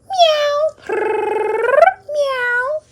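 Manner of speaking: high meow with a rolled purr; kitty cat noises. Pretend. Puppy in cat costume announcing itself, high meowing. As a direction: mimic a kitten, high and trilling. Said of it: "That sounds like Rainbow Puppy".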